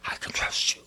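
hushed, unvoiced whisper to God; soft whispered confession of trust; whispered prayer. Shown as a demonstration. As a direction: whisper it, barely aloud. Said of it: "Lord, I'll whisper it".

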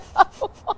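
high, real burst of giggles; uncontrollable laughter. Real, not acted. Host tries to get content into show, laughing helplessly. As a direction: break up laughing, unable to stop. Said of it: "I can't stop laughing".

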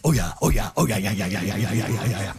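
boastful dragon. Acted. Dragon bragging to Elizabeth in a deep, sinking voice. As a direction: growl a proud agreement.

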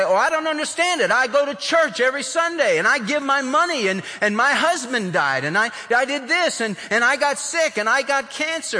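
hammed-up self-pitying parishioner, rattling off grievances; complaining churchgoer. Churchgoer complaining that bad things happened to her, whiny. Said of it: "and they say".

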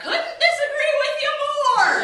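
high, loud, stretched-out mock holler; yelling back. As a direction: holler back at the cheering crowd, drawn out. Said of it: "makes me wanna yell back".

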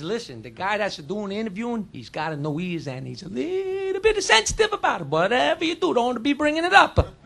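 put-on confidential warning, lightly Italian accent; hint of Italian accent, hushed then rising; Italian guy. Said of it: "Italian guy goes up to the Polish guy".

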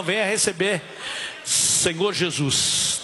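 ordinary delivery

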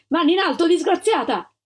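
high, loud command; police shout. A narrator's reading. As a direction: yell it at the cornered woman. Armed agents surround her.

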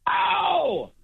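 piercing, high-pitched full-voice yell; record-attempt shout. Shown as a demonstration. World record holder demonstrating for radio hosts, huge sustained shout. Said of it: "I'll do the word for you".

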